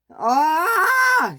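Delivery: high, loud yell of dismay; sharp high shout; angry cry. Acted. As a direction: cry out in frustration. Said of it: "said Henry".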